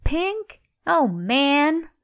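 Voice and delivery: put-on high-pitched teenage boy's dismay; dismayed surprise. A narrator's reading. Luke reacts to dyed underwear.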